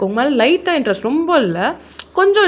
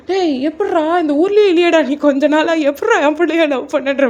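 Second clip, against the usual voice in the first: a mother hearing the news she never saw coming; mother demanding how he could love her daughter, in shock; 6 semitones higher than usual. Acted.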